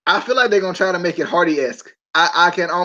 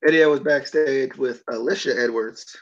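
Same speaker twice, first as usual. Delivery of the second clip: ordinary delivery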